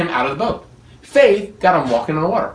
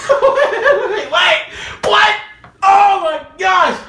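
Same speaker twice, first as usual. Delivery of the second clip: mock shocked outburst; 12 semitones higher, much slower than usual. Preacher mocking shock at Jesus' rebuke of Peter. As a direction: squeal it like you can't believe Jesus said that.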